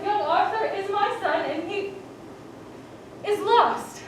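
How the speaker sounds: usual speaking voice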